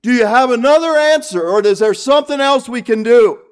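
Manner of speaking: high, loud plea for another answer; doubting shout. Retold or imitated. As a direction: cry out like the man on the cliff. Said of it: "we're saying".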